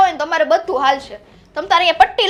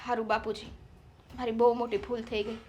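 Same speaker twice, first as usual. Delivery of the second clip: much softer, lower mock-humble apology; sarcastic apology; 4 semitones lower, 14 dB quieter than usual. Daughter-in-law mock-apologizing to father-in-law, much softer. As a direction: pretend to be sorry, dripping sarcasm.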